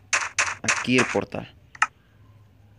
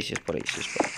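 whispered to himself, softer; muttered to himself; 7 semitones lower, 7 dB quieter, much faster than usual. Real, not acted. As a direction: mutter it under your breath. Muttering to himself while searching.

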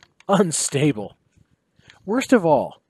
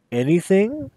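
narrow-eyed question; 5 semitones higher than usual. Acted. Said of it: "looking at him narrowly".

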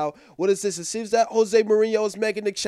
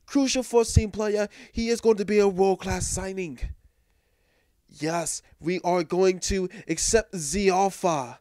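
put-on French accent. A club announcing its big new signing, in a crappy French accent. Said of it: "my crappy French accent".

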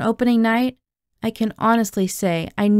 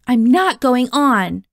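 slow, loud, higher-pitched refusal; raised voice, firmly defiant; 4 semitones higher, 6 dB louder, slower than usual. A narrator's reading. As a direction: dig in loudly, stretching the words. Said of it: "I said loudly".

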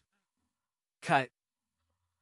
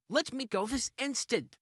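ordinary delivery

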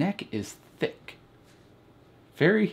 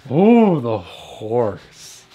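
excited gasp; 11 dB louder, much slower than usual. Real, not acted. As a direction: burst out at the sight. Spots the horse ornament.

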